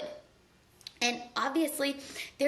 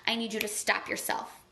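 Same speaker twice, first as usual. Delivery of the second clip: usual speaking voice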